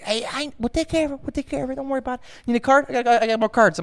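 high-pitched breezy dismissal; squeaky-high fast-talking reassurance; Sebastian Maniscalco. Retold or imitated. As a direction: imitate a contractor glossing over every worry. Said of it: "talks exactly like Sebastian, Sebastian Maniscalco".

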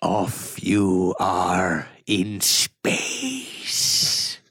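put-on extremely slow, dramatic accented villain; Ricardo Montalban impression. Taunting Richard in space, slow Montalban-style villain voice. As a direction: linger on every word, fading into whispery menace. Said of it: "one of my favorite impressions".

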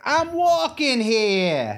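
put-on high, drawn-out New York accent; hammed-up New Yorker's indignant cry, New York accent. Midwest transplant protesting in the street, mock New York accent. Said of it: "where'd that accent come from?".

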